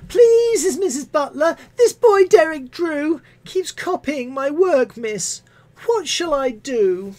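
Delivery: put-on high, slow childish whine; whiny pupil. Pupil telling tales to Missus Butler, high whine. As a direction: plead like a tattling schoolchild. Restarted with a hat on.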